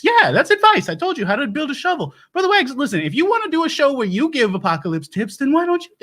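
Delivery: high-pitched, defensive comeback; antagonistic. Acted. Host snapping back at a chat critic, antagonistic. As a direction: jump up high and argue the point. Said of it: "being so antagonistic towards you".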